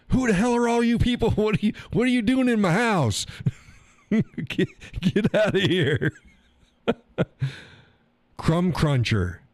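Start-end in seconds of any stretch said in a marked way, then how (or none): 0.1-6.1 put-on high, confused old man yelling at strangers; high cracked voice of a muddled grandpa, half laughing; confused old cowboy. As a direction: play a befuddled old man ordering people out of his house.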